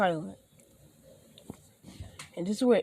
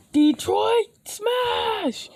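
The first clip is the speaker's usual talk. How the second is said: high, drawn-out superhero shout; attack cry; 5 dB louder, much slower than usual. Acted. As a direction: yell the move name, stretching it out. All Might's attack cry.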